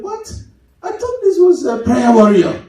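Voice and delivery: high-pitched, incredulous disbelief; squeaked surprise rising into disbelief; mock astonishment. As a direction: act shocked, voice leaping up. Acts out astonishment at prayer warrior.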